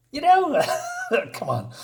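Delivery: high laugh breaking through the words. Real, not acted. Vlogger laughing at the G.I. Joe panel, real laughter. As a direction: chuckle it off at how corny it is.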